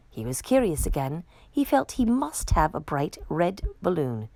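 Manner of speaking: ordinary delivery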